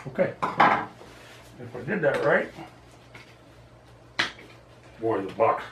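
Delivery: talking around a cigar; cigar in mouth. Real, not acted. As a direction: lips clamped on cigar. Seen 3: Cigar clamped in mouth throughout while he cuts the box open.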